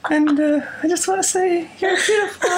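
very high, plaintive falsetto; hammed-up high, drawn-out croon. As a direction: parody a sappy balladeer.

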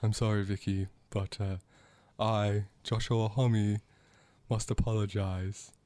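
slow, incredibly measured celebrity impression. Singer apologising to [name] for a kick, slow and measured. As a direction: draw out each phrase with deliberate, measured pauses. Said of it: "he speaks slowly and incredibly measured".

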